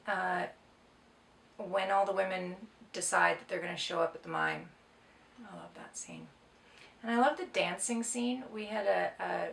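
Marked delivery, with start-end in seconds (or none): 5.4-6.3 breathy whisper of affection; hushed whisper, fond. Real, not acted. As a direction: whisper it like a secret you adore. Fond aside about favorite scene.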